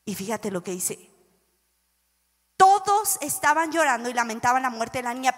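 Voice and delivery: ordinary talk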